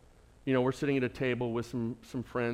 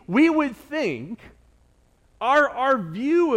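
full-force preaching; 9 semitones higher, 10 dB louder than usual. Acted. Pastor presses the congregation, preaching at full force. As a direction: drive it home to the pews.

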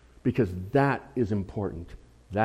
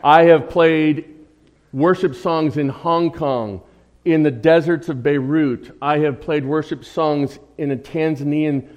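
emphatic; 4 semitones higher, 9 dB louder than usual. Real, not acted. Pastor insisting on the guitar's value.

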